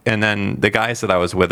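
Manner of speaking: ordinary talk, nothing marked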